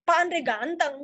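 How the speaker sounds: emphatic stress with GANTENG drawn out; firm emphasis landing on "ganteng". Shown as a demonstration. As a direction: insist on it, stretching the first syllable of "ganteng". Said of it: "ada yang penegasan".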